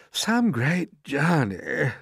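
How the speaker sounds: slow, drawn-out sarcasm; limping centipede. A narrator's reading. Centipede limping across the room, slow drawn-out complaint. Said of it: "the centipede said, limping across the room".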